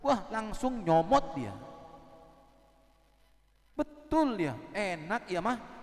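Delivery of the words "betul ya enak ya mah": louder and higher, eager praise; delighted husband. Acted. Husband praising his wife's fresh mendoan, eager and louder. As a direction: gush over the fried tempeh like a hungry husband.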